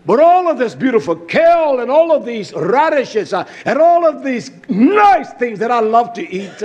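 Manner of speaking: high, swelling preacher's delivery; fired-up sermon cry; exultant preaching. Acted. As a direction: lean hard and loud on key words. Preaches at full force.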